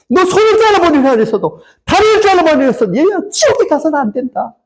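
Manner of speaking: high, anguished preacher's cry; pleading outcry. Acted. God cries to his child to avoid hell, pleading outcry. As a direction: cry out each warning at high pitch. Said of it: "하나님을 외치는 거예요".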